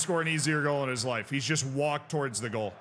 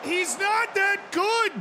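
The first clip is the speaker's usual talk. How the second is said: mock voice; 5 dB louder, slower than usual. Mocking a player's confidence.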